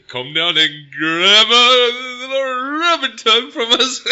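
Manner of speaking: high, loud, slow cheesy ad pitch; mock ad voice. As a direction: sell it like a cheesy commercial. Mock ad for rub and tug.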